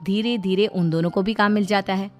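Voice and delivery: plain reading voice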